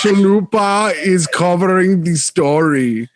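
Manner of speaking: put-on drawn-out, clumsy Russian accent; botched Russian accent drifting into German. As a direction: do a bad Russian accent, slowly. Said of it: "have to do a Russian accent".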